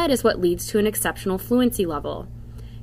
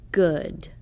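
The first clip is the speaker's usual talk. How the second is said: Good said quickly as one whole word; quick plain Good; read quickly; 3 semitones lower, 3 dB quieter than usual. Shown as a demonstration. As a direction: snap out Good fast. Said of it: "Short uh word should be read like this".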